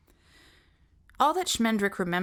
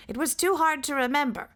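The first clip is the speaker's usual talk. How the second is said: sentry voice; 9 semitones higher, 3 dB louder, faster than usual. Acted. Sentry high voice.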